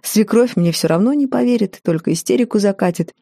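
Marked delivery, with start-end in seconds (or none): none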